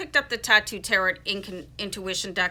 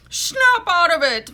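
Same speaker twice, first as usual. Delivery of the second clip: a louder, slower, lightly voiced [name]; hint of [name] belting it out; [name] impression; 9 semitones higher, 9 dB louder, slower than usual. Tarot reader telling viewers to break free, doing a light [name]. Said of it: "That's my [name] impression".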